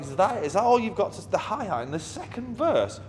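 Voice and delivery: high-pitched mock outrage, can't believe it; incredulous. Told to turn the hi-hat up, incredulous retort. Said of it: "And I was like".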